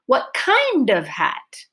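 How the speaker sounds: long, high "kind", then falling away on the rest; stress on KIND. Shown as a demonstration. Teacher models the listener's question, stress on "kind". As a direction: stretch and lift "kind". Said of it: "clear vowel is on kind".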